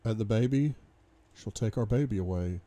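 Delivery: ordinary delivery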